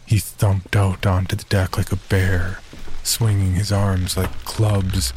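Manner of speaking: plain reading voice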